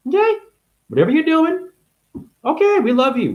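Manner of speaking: a high, teasing sweetness; sing-song reassurance. Real, not acted. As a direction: coax them gently, voice up high.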